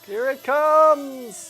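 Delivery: high, loud, stretched-out announcement; drawn-out call. Real, not acted. Player hyping what is coming, drawn-out high call. Louder repeat as event arrives.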